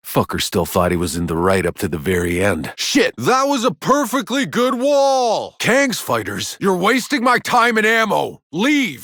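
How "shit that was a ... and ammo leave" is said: angry battle shout. Acted. Soldier ordering Kang's fighters to leave, angry shout. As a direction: roar them off your turf.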